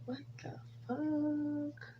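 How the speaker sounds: breathy whisper, voice dropped right down; whispered disbelief. Real, not acted. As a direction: hush it like a shocked aside. Disbelieving aside about the movie.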